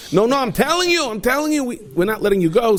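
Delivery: high, urgent insisting; desperate pleading. Acted. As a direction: beg him not to leave. Pleading with the tailor.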